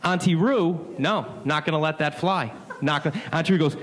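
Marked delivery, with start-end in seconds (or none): none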